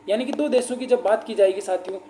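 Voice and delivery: ordinary delivery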